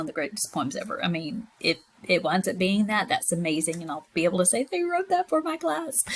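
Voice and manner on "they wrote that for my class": high, proud boast; a proud teacher's boast; proud brag. Pretend. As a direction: brag to imagined colleagues. Teacher imagines bragging proudly.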